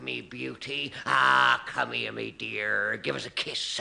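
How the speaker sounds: put-on a low, growling old sea dog leering at his lass; leering pirate. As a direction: coax a kiss out of her like a lecherous pirate.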